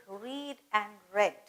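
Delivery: first "read" as "reed", second "read" as "red"; "read" both ways, reed and red; two pronunciations. Shown as a demonstration. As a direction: say "read" with a long ee, then "read" with a short e. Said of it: "can be pronounced as both".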